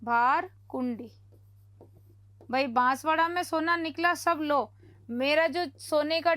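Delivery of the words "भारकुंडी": भारकुंडी said slowly, syllable by syllable; slow, spelled-out. Shown as a demonstration. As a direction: stretch out भारकुंडी for memorising. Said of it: "एक शब्द और आता है यहाँ पे".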